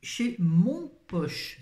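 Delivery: "Mon Poche" spelled out slowly for the audience; stress on Mon Poche. Shown as a demonstration. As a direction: drag out "chez Mon Poche", hitting "mon". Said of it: "c'est rigolo".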